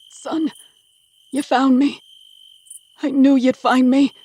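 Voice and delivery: high, loud cry of desperate relief; a starved prisoner crying out to his saviour son. Acted. Starved father sees rescuing son.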